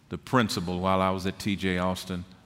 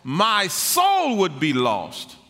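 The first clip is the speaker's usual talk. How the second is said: full-force preaching; 12 semitones higher, 6 dB louder than usual. Real, not acted. Preacher testifying of faith to the congregation, fervent and loud. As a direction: proclaim it to the congregation, stretching the key words.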